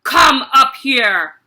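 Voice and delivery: loud slow shouted order; booming shout. Acted. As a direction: command him to the platform. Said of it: "the Trunchbull shouted".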